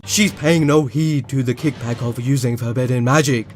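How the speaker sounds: Zagred impression. Finally voicing Zagred for viewers, deep villain voice. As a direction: menace it out like the villain. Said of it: "let me try to do his voice".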